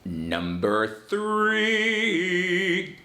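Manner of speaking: very slow drawn-out heading; announcing a heading. Shown as a demonstration. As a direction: stretch it out like a title card. Heading over music.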